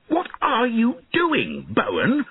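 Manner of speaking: high, drawn-out outraged shout; indignant high-pitched challenge; angry shout. A narrator's reading. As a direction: demand it angrily, voice pitched high. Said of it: "he shouted angrily".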